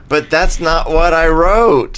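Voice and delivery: loud, high, drawn-out mock protest; indignant protest. Sender protesting to someone who misread a text, mock indignation.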